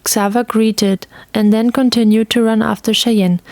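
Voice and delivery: plain reading voice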